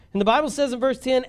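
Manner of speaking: ordinary talk, nothing marked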